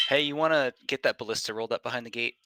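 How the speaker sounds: loud guard call. Acted. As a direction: shout it over the gate. GM voices guard calling out.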